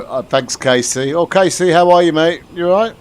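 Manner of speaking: ordinary talk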